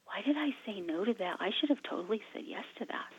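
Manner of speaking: hushed, near-whispered self-reproach; soft retold late-night thought; regretful inner voice. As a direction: murmur it to yourself, regretting it. Retold thoughts lying awake at night.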